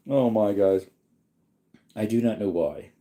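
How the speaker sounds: ordinary delivery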